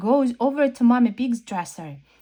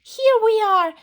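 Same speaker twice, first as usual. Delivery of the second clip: high loud happy shout; 13 semitones higher, 8 dB louder than usual. A narrator's reading. Peppa shouting to Mommy Pig that they are here. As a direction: burst back in calling out. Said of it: "shouts Peppa".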